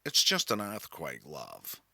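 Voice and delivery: low, quiet, unhurried American drawl; retelling Fran brushing off an earthquake in her drawl; transatlantic drawl. Retold or imitated. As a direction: shrug it off in a lazy transatlantic drawl. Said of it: "she replied in her transatlantic drawl".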